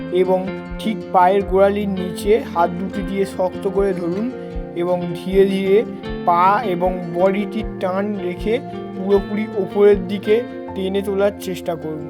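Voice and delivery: ordinary talk, nothing marked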